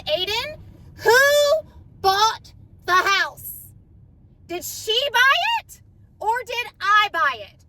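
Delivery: high, loud, slow demand with a crying voice; mother demanding her son answer, crying. Retold or imitated. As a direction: tearfully insist he tell the truth. Retold tearful demand to son.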